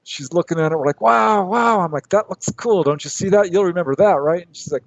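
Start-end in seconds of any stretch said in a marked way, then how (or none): 1.0-1.8 much higher, breathless wow; amazed wow. Retold or imitated. Showing sister the glowing flying saucer, amazed. 2.1-4.5 higher, eager coaxing; excited urging. Retold or imitated. Urging sister to remember the saucer, excited and higher.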